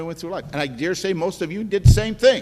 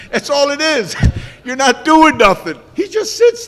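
laughing; 8 semitones higher, 11 dB louder than usual. Real, not acted. Mocking bobbleheads, laughing.

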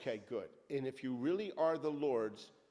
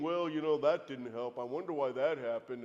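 lower, grumbling mock whiner; mock complainer; 4 semitones lower than usual. Preacher mocking a believer who dwells on the past, lower mock grumble. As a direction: mimic a self-pitying grumbler. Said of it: "by looking back and saying".

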